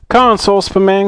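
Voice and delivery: high, mild call. A narrator's reading. Joe summoning the saucepan man, calling out. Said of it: "called Joe".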